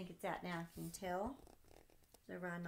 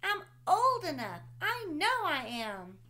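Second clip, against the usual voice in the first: put-on insistent little boy; 8 semitones higher, 12 dB louder than usual. Sam shouting to dad he is old enough, insistent child. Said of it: "asked Sam".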